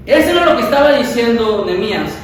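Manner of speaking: ordinary talk, nothing marked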